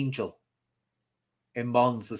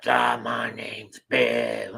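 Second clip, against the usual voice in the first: slow, lightly voiced gruff man named Bill; a hint of a harsh spirit voice, slowed right down; harsh man's voice; much slower than usual. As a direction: drag it out slowly like a mild mock possessed girl. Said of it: "talking with this really harsh voice".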